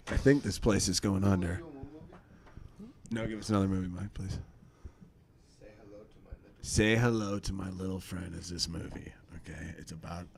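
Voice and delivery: low, soft, confidential delivery; hushed and low, trailing to near whisper; weary video store clerk in a scene. Acted. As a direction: play a clerk quietly pitching a movie.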